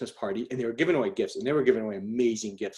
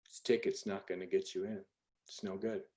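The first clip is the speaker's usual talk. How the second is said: quiet, low Irish doorman imitation; Irish brogue; 5 semitones lower, 13 dB quieter than usual. Irish ticket taker refusing entry, soft brogue. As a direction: refuse softly in a light Irish accent. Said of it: "he's an Irish bro".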